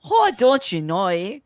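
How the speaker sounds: forced Canadian twang, big "eh" at the end; cartoon Canadian "eh?"; exaggerated Canadian accent. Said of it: "me just forcing my natural accent".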